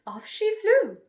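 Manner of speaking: ordinary delivery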